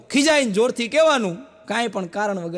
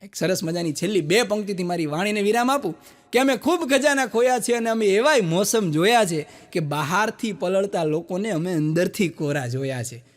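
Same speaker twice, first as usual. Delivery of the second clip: ordinary talk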